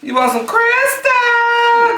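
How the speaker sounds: high, slow teasing; mock surprise at crisps; teasing question. Joking mishearing, high and slow.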